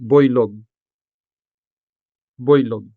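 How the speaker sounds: put-on boylog said clearly as "pɔɪlʊɡ" with a falling tune; Hiligaynon word boylog. Shown as a demonstration. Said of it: "For example".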